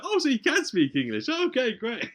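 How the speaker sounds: high, loud mock surprise; sarcastic cop. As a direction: drip with sarcasm. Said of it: "He's like".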